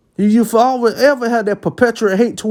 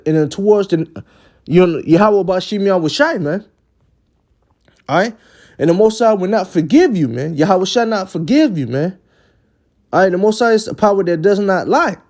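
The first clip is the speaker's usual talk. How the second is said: ordinary delivery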